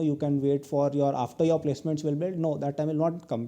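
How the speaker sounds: ordinary talk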